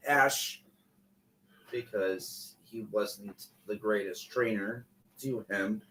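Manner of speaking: ordinary delivery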